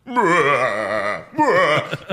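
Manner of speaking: mock villain laugh; hammed-up evil chuckle. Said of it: "like, villain laugh".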